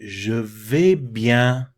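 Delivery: teacher modelling the answer to the class, slow and clear; slow French demo. Shown as a demonstration. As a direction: spell it out slowly with your voice, "Je vais bien". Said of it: "they would say".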